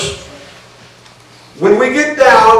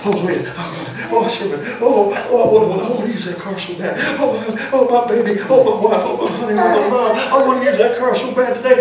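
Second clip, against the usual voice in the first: hammed-up swooning, moaning oh after oh; mock worked-up pleading; 2 semitones higher, 5 dB quieter, faster than usual. Husband buttering up his wife for the car, mock worked-up pleading. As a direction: overdo the husband's pleading, softly and in little bursts. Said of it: "get myself all worked up in some kind of mental attitude".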